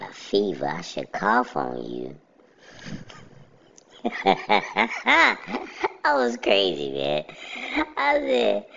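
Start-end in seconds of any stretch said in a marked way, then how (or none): 4.0-6.0 sudden high-pitched laugh. Real, not acted. Laughing at own story.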